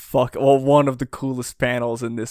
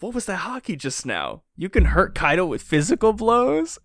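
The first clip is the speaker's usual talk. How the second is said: higher-pitched disbelief, rising as it goes; imitating stunned incredulity; mock astonished Kid; 7 semitones higher than usual. Kid reacting to Kaido getting hurt, mock astonishment. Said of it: "And Kid is like".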